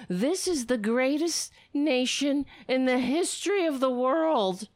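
high, ringing sarcastic proclamation; mock patriotic cheer. Host reading senator's July fourth tweet, mockingly rousing. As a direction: read it with overblown fake pride.

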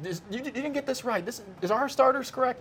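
high-pitched imitation of a flustered coach; mock coach querying the lineup; worried coach. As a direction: stammer out a high-voiced complaint. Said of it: "coach is walking over the table like".

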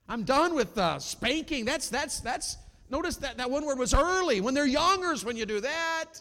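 high, loud preacher's cry; ringing, emphatic sermon delivery; full-force preaching. Real, not acted. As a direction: preach it out to the whole room.

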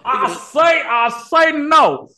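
very high, slow Foghorn Leghorn drawl; cartoonish southern rooster drawl; southern Confederate voice. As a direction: stretch it out in a high southern bluster. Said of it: "foghorn or leghorn".

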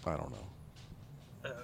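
slow, breathless whisper. Real, not acted. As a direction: barely whisper it, slowly. Sulky reply after being teased.

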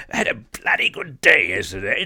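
put-on a lighter British accent on "had a bloody good day yesterday", pitched back down; milder British accent. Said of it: "That was a great accent".

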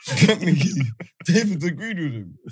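ordinary talk

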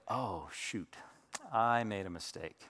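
low, soft aside to himself; sheepish realization. Retold or imitated. Dad realizing he scolded wrongly, hushed and sheepish. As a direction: drop right down as the mistake sinks in.

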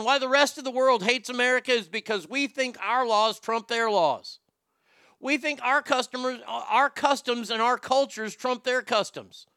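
high-pitched, indignant rant; worked up. Real, not acted. Host ranting about American arrogance abroad, worked up. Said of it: "I'm getting worked up".

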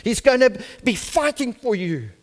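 high-pitched preaching building to a loud climax; full-force preaching. Real, not acted.

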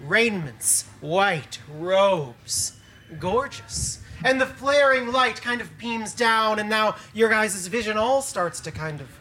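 slow, soft descriptive telling; slow awed narration. A narrator's reading. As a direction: paint the angel in awe. Describes the angel's descent.

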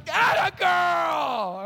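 put-on very slow, high, loud atta girl; Jesus proudly praising the woman; delighted praise. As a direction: cheer her on with joy. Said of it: "And Jesus is like".

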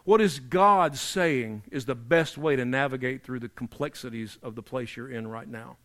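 ordinary delivery

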